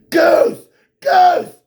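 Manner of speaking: very high, loud cracking shouts; mock yelling. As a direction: scream it like a madman. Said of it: "all she sees is this".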